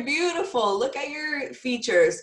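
convince her she is beautiful; emphatic reassurance. Retold or imitated. Mother telling her six-year-old she's beautiful, high and insistent. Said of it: "I had to have that hard conversation".

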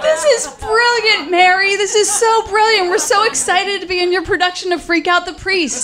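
put-on high squealing kid excitement; gushing kids. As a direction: gush like adoring little siblings. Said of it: "So we were like".

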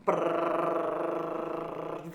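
rolled tongue drumroll brrr; drum roll. Pretend. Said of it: "I'll do the drum roll".

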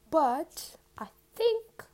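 squeaky high crack. Real, not acted. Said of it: "I don't know where that sound come from".

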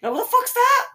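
high squeal of disbelief; incredulous squeal. Real, not acted. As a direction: shriek it, baffled. Incredulous squeal at retold remark.

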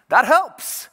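loud, high burst of mock relief; exaggerated relief. Flyer hearing the pilot's all-clear, exaggerated relief. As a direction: exclaim it as the hugely relieved passenger.

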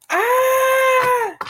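sudden high, loud squeal of surprise; delighted high-pitched shriek; surprised squeal. Real, not acted. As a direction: yelp it out, caught off guard. Excited squeal at chat question.

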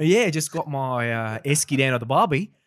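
a cheerful Australian mate at the barbie; Australian accent. Shown as a demonstration. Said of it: "for Australian".

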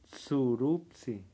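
surupsi spelled out slowly, syllable by syllable; testing how surupsi sounds, slowly; careful sounding out. Shown as a demonstration. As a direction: sound out surupsi slowly, unsure of each letter. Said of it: "No sé cómo se pronuncia la P y la S".

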